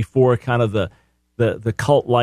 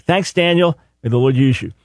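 ordinary delivery

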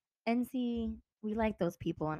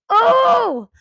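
much higher, loud exclamation; hammed-up eager shout; mock excited gasp; 13 dB louder than usual. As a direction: leap at the chance to tell. Reacting to who did this.

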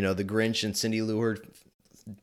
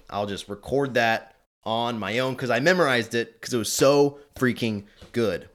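ordinary talk, nothing marked

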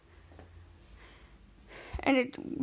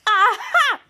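high, loud triumphant "aha"; acted gotcha cry; 8 semitones higher, 6 dB louder than usual. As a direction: crow it gleefully, like a gotcha. Character triumphs in retelling.

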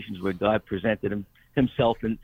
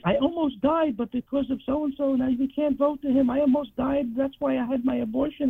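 high, mocking commercial voice, much higher pitch; mock TV ad woman; 14 semitones higher than usual. Patient mocking television ad speaker, high parody voice. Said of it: "commercials on television".

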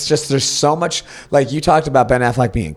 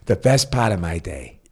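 lower, softer movie-accent impression; Ben Affleck; 5 semitones lower, 5 dB quieter than usual. Finishing co-host's film quote, low imitated accent. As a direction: lightly put on a working man's accent, dropping quieter. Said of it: "Was that pretty good?".

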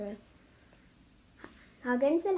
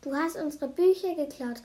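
raised, louder accusing voice; calling out; 4 semitones higher, 5 dB louder than usual. A narrator's reading. Annabelle accusing Nina of stealing books, calling out. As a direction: accuse with a moderate outcry. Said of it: "rief Annabelle".

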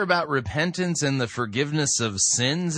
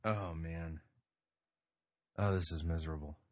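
very low, soft, dejected aside; hushed, weary exasperation; muttered misery; 12 semitones lower, 13 dB quieter than usual. Real, not acted. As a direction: grumble it under your breath. Dismayed aside after the played clip.

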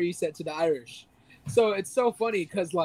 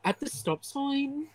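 slow, higher, softer Irish voice; fed-up Irish Siri; 7 semitones higher, 6 dB quieter, slower than usual. Retold or imitated. GPS sick of giving directions, imitated fed-up Irish accent. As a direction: draw it out as a weary Irish GPS. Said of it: "so fed up with giving directions".

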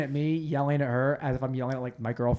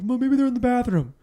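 high-pitched, louder acted plea; high retold excuse; acted protest; 10 semitones higher, 5 dB louder than usual. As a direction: push the suggestion up high. Pleading with staff over keys.

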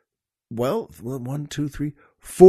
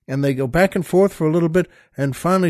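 usual speaking voice

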